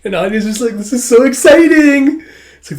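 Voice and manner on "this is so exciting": high, loud, stretched squeal; thrilled child. Retold or imitated. Child watching a challenge accepted, thrilled. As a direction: gush like an overjoyed kid.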